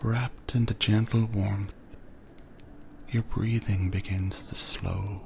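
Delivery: plain reading voice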